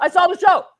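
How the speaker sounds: repeating it hard to drive the point home; emphatic insistence. Real, not acted. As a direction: insist on it, near shouting.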